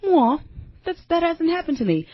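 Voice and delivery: high, whiny self-pity, lightly done; imitating a hurt little voice; mock whiny voice. Quoting her own hurt reaction to the post in a high whine.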